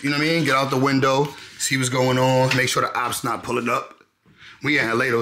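usual speaking voice

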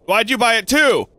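ordinary delivery

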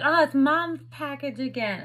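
son's voice. Retold or imitated. Son voiced in retold story.

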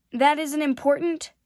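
put-on a boy's upset, rising protest. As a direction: fling the question back, hurt. Prince upset, face turning red.

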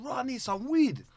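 slow, high pleading ask; mock-pleading for weed. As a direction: draw it out like you really need it. Retelling his request in barbershop.

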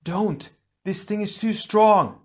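high, alarmed female character; distressed high-pitched plea; gasped warning. A narrator's reading. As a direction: warn him off in a high, strained voice. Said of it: "Gali gasped".

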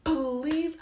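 high, loud begging whine; mock tearful plea. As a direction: imitate someone begging through tears. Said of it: "She starts crying. She's like".

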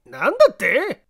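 high startled shout. Acted. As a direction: jump at the words. Kinkou startled.

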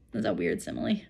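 low, soft deadpan comment; low muttered deadpan aside; wry aside. Real, not acted. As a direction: drop out of the story to remark dryly.

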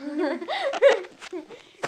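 loud, high laughing fit; burst of laughter. Real, not acted. Sister bursting out laughing while arguing with brother. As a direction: crack up mid-argument. Laughing during sibling squabble.

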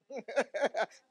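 loud laugh; real burst of laughter. Real, not acted. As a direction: laugh in release after intense preaching. Laughing after the climax.

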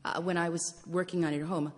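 usual speaking voice

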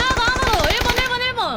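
high, loud urgent callout; excited callout. Real, not acted. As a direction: call it out fast to the team. Calling out enemies mid-fight. (다급하게 외치는 콜)

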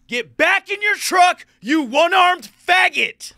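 high, loud, slow furious yell; top of my lungs. Retold or imitated. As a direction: scream it at the top of your lungs. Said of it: "I get out of the truck and I go".

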